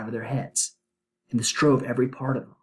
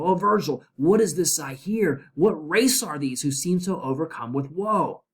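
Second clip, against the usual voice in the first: dramatic poem reading; 6 semitones higher than usual. Acted. Dante crying out to Virgil, read dramatically. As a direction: call out to the master in horror.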